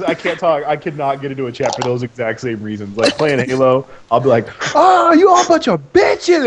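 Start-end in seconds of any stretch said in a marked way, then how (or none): 4.8-6.4 hammed-up high, loud, drawn-out shout; trash-talking yell. Gamer re-enacting his Halo trash talk, yelling high. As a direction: heckle the whole lobby at full pitch. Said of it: "just, like, talk mad shit".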